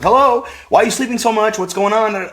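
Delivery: put-on high, puzzled little boy voice; little kid voice. Young grandson asking grandpa what's happening, high childish voice. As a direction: sound like a small confused child.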